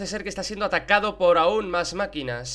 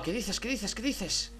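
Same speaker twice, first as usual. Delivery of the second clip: soft disbelief; 3 semitones higher, 8 dB quieter, slower than usual. Real, not acted. Disbelief at the game.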